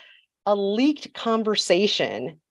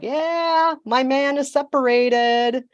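high, gloating mock Camilla; a hammed-up, pleased-with-herself side piece; smug mistress; 8 semitones higher, slower than usual. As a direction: crow about it, slowly, like a smug mistress. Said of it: "She's like".

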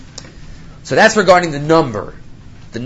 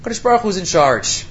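ordinary talk, nothing marked

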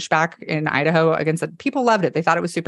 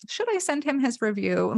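sly petty aside; 7 semitones higher, 6 dB quieter than usual. Retold or imitated. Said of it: "I was like".

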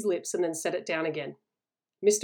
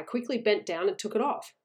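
ordinary delivery, nothing marked

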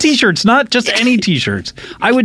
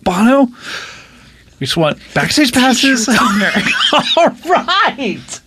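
laughing shout; 5 semitones higher than usual. Acted. Laughing appeal shouted at [name].

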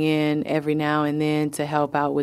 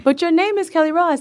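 put-on high, louder, fast kid voice; insistent child; 11 semitones higher, 4 dB louder, faster than usual. Mother imitating her son arguing about her name, high and fast. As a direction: push back like a stubborn seven-year-old.

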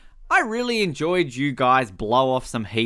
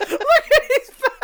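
laughing; 5 dB louder than usual. Real, not acted. Laughing hard at scene.

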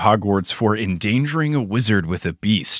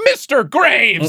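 drawn-out, high scandalized exclamation; hammed-up scolding cry of a name; mock outrage; 11 semitones higher, much slower than usual. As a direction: overact being shocked at a rude remark. Acting out Tina's overreaction.

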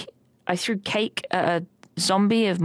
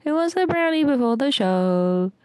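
sing-song offer; 7 semitones higher than usual. Retold or imitated. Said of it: "I was just like".

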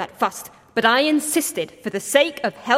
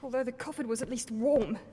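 soft cold murmur; 8 dB quieter than usual. Acted. Shivering in cold basement.

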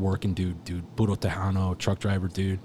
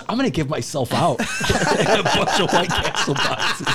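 laughing; 9 semitones higher, 8 dB louder than usual. Real, not acted. Joke about own cremation.

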